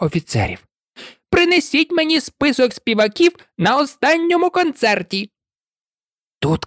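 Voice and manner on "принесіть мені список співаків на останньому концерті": imperious, higher order; a queen barking for a list; high, haughty command. A narrator's reading.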